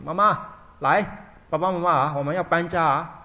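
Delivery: son loudly coaxing his blind parents onto the cart; calling out. Retold or imitated.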